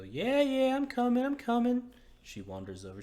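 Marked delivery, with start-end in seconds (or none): 0.0-1.9 high, loud woman calling from inside. Acted. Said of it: "you hear a woman go".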